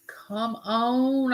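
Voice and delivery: normal talking voice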